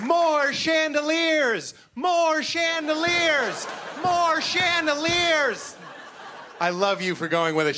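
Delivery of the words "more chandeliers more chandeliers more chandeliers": slow, high drawn-out yell of "More"; excited yell. Acted. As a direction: belt it out stretched and high. Crowd shout-along callback.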